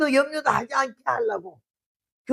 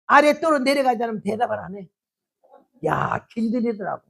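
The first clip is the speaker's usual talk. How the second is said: usual speaking voice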